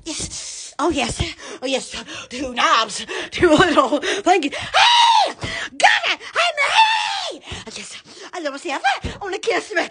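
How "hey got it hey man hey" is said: high, bright excited hollers; excited calling out. Real, not acted. Greeting someone across the room with excited hollers. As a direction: call out with a jolt of excitement.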